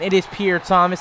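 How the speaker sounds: ordinary delivery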